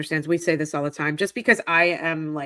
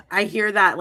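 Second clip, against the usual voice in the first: ordinary delivery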